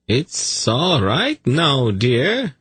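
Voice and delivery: high, slow, cooing reassurance; soothing. A narrator's reading. Zadi calming his little snake, soothing. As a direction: coo it as to an upset pet. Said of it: "he said soothingly".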